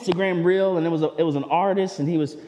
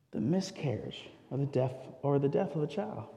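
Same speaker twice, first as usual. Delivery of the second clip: much softer, grave; tender solemn hush; hushed and sorrowful; 5 semitones lower, 10 dB quieter than usual. Acted. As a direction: drop the voice for the loss. Speaking of child loss.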